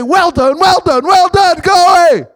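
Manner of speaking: Jesus welcoming a faithful servant; exultant Jesus voice. Pretend. Voicing Jesus greeting believers.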